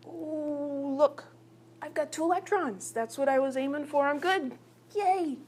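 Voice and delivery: high, delighted little atom. Acted. Hydrogen finds it has two electrons, high happy atom voice. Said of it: "So hydrogen's thinking".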